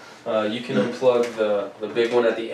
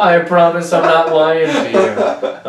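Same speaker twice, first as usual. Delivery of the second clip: laughing protest; 6 semitones higher, 8 dB louder, slower than usual. Real, not acted.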